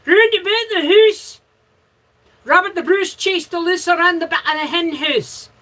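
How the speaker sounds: high, loud hammed-up Scottish brogue; mock Scottish accent. Friends teasing her Canadian speech, mock Scottish accent. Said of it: "And everybody goes".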